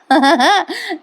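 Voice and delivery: short high amused laugh; real laugh. Real, not acted. Host laughing while recapping the episode, amused burst. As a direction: laugh at the joke you just told.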